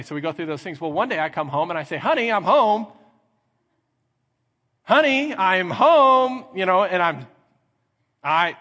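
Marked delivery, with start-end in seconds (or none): 2.0-2.9 raised, louder homecoming call; calling out on arrival. Acted. Husband comes home one day to his wife, calling out. 4.8-6.4 much higher, louder repeated call; insistent calling out. Acted. Husband calls to a wife who hasn't come, insistent.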